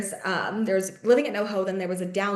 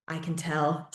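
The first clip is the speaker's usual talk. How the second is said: low, soft imitation of an unruffled mentor; neutral tone; 4 semitones lower, 5 dB quieter, slower than usual. Mentor answering a newcomer's introduction, imitated neutral tone. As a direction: imitate his quiet, neutral delivery, pitched down. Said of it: "neutral tone that he has".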